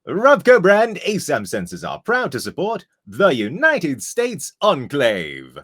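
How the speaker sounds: loud, slow, high robot salesman; Robco salesman voice. Pretend. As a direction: pitch it like a Robco salesman bot. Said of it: "Robco salesman voice".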